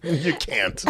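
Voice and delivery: ordinary talk, nothing marked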